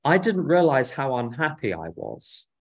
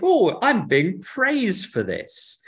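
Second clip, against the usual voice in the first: mock delight, slow and higher-pitched; drawn-out, higher "oh, I'm being praised"; naively pleased; 7 semitones higher, 3 dB louder, much slower than usual. Non-British listener thinks they are being praised, naively pleased. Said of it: "you'll think".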